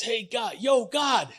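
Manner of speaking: high shouted call up to God, a tough contractor yelling upward. Acted. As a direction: bellow up at the sky like a guy on a job site. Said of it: "That's how contractors talk".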